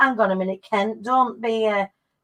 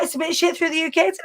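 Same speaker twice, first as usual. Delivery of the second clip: ordinary talk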